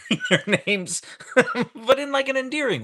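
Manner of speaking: high, loud, through laughter; laughing. Real, not acted. Streamer teasing a parent about repeated names, laughing. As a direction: burst out laughing mid-sentence. Laughing while apologizing to viewer.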